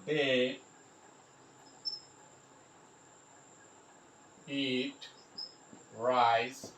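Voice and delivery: word by word with long pauses; mot à mot. Shown as a demonstration. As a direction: dictate it slowly, one word at a time. Spelling out example while writing.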